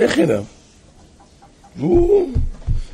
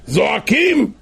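high, raised exclamation; acted cry; 8 semitones higher, 4 dB louder, slower than usual. Storyteller sums up the couple crying to God, high cry. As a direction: call it out high and strong.

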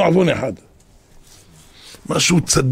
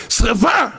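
retold scream; 8 semitones higher, slower than usual. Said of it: "צעקתי".

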